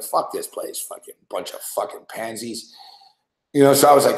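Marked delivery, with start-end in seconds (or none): 0.0-2.7 muttered, much softer grumble; under-breath curse. Retold or imitated. Host retelling his grudge against Vancouver, muttered curse. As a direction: sneer it under your breath.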